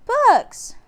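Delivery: high, loud delighted shout; girl calling from the tree house window. A narrator's reading. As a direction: yell it down gleefully. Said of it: "she shouted".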